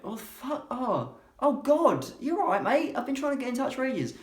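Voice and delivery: a sleeper jolted awake by God; startled panic. Acted. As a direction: wake up in a fright.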